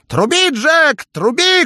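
very high, slow, loud jester shout. A narrator's reading. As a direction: cheer him on at the top of your voice. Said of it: "кричал Шут". (громкий крик шута)

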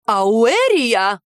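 loud, sharp cry of a name; shrieking woman. A narrator's reading. The silent woman shrieks at the heroine, loud shriek. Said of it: "взвизгнула женщина".

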